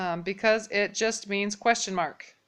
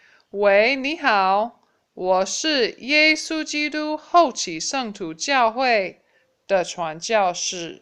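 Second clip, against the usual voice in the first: louder and slower, each word drawn out; slow, clear demo; 3 semitones higher, 7 dB louder, slower than usual. Shown as a demonstration. Teacher repeating the greeting for the class, louder and slow. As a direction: model the phrase loudly for the class. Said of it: "Do that again".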